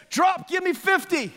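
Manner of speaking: a mock military yell in your face; drill sergeant. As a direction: bark orders like a drill instructor. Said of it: "the military guy standing there".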